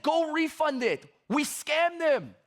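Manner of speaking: high, appalled retold order, last words drawn out; shocked and mad. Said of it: "I was so shocked, mad".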